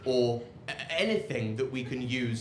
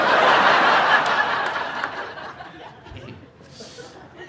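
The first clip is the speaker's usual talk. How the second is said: long breathless laugh; helpless laughter; 7 dB louder than usual. Real, not acted.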